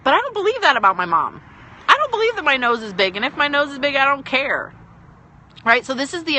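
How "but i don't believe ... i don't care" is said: higher, firm mock comeback; acted firm rebuttal. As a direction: stand up to an imagined bully. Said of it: "And you're like".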